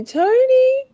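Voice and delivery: squeaky high call of a name; high squeal. Acted. Calling out Tony playfully.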